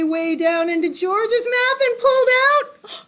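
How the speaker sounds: rising, stretched-out storytelling; building suspense. A narrator's reading. Suspense builds towards the reveal.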